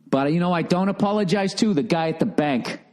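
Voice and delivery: usual speaking voice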